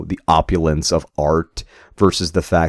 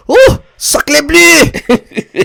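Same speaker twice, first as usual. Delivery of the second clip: high, slow, loud French exclamation; hammed-up shocked Frenchman; French accent; 8 dB louder, much slower than usual. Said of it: "'Cause, you know, French".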